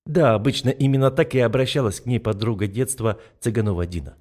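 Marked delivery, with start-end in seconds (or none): none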